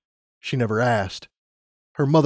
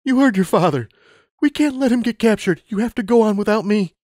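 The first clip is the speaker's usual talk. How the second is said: higher, louder, trembling plea; tearful mother; 10 semitones higher, 4 dB louder than usual. Acted. Mother crying as she sends Lumina away, tearful. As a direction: push through tears. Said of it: "her voice trembled".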